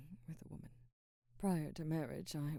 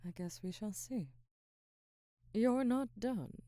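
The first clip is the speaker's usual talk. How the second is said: normal talking voice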